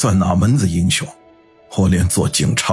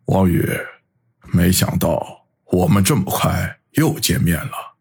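slow, rueful greeting; wry bitter smile; 3 semitones lower than usual. A narrator's reading. Chen Yuan meeting Wang Yu again so soon, wry. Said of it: "苦笑地说道".

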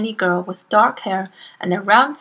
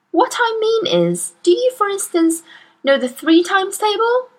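lightly voiced teacher; 11 semitones higher, 3 dB louder than usual. Narrator voices Miss Honey.

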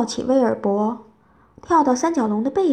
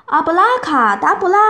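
loud, higher-pitched magic chant; shouted spell; 5 semitones higher, 6 dB louder than usual. A narrator's reading. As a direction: call out the magic words loudly. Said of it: "然后大喊一声".

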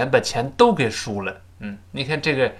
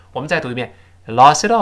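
usual speaking voice

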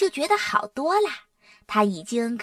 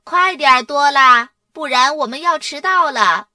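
loud, raised hurry-up call; 4 semitones higher, 7 dB louder than usual. A narrator's reading. Mum hurries Dora so they are not late, calling. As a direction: call urgently to someone in another room. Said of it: "妈妈喊着".

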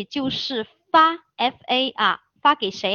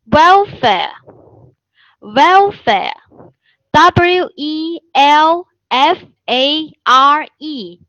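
welfare twice, then its letters very slowly and louder; loud dictation drill; 4 semitones higher, 6 dB louder, much slower than usual. Shown as a demonstration. Teacher dictating welfare loudly and very slowly. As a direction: call it out slowly for students to write down.

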